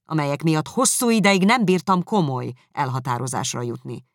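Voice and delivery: loud, bitter, haughty voice; proud angry retort. Acted. Darcy retorting to Elizabeth's charges, loud and haughty. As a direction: throw his pride back at her.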